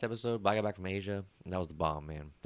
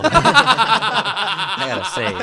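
high, slow laughing aside; 11 semitones higher, 11 dB louder, much slower than usual. Real, not acted. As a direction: say it while cracking up.

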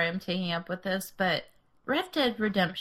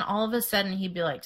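ordinary talk, nothing marked

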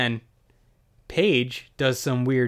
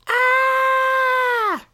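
long, loud, very high scream; mock scream; 6 dB louder than usual. Elvira to the camera in crash zoom, hammed-up scream. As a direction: hold a piercing shriek. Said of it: "and she goes".

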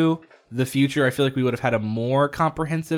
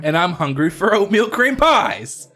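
King of the Hill quote; 6 semitones higher, 6 dB louder, slower than usual. Retold or imitated. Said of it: "As a King of the Hill reference".

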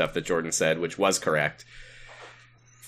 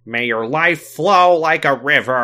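high, loud, slow blessing; Sam voice; 6 semitones higher, 6 dB louder, slower than usual. Acted. Host leading the congregation in a prayer, hammed-up character voice. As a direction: intone it grandly, stretching each word. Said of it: "in Sam voice, please".

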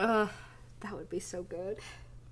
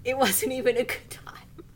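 whispered aside; 4 semitones higher than usual. Real, not acted. Muttering a verdict on Iron Fist in a whisper. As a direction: breathe it as a secret.

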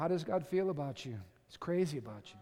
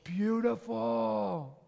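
slow, drawn-out doting gush; adoring dad marveling at a little daughter; acted adoration; 4 semitones higher, 4 dB louder, much slower than usual. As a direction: stretch each word in hammed-up admiration. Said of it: "She's wanting you to say".

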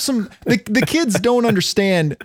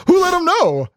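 mock indignant; 9 semitones higher than usual. Mock outrage at who told.

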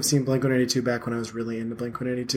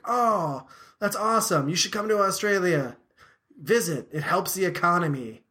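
a hint of the Aussie singer, chirpy and raised; light Australian accent; 6 semitones higher than usual. Singer urging fans to visit Australia, light mock Australian accent. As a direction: lightly mimic an Australian frontman plugging tourism. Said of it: "a weird accent".

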